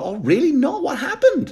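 high, breaking into a laugh, fake shock; fake surprise. Comic pretends to the cop he knows nothing, high and laughing. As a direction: act shocked while barely holding a laugh.

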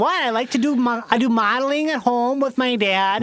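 put-on caricatured Upper Midwest accent; Wisconsin accent. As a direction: protest innocently in thick Wisconsin vowels. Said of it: "has that accent?".

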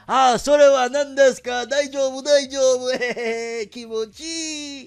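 high, loud hammed-up Japanese businessmen chatter; country club dudes chatting in Japan, high and hammy; mock Japanese executives. 日本のビジネスマンの大げさな物まね. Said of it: "They were there like".

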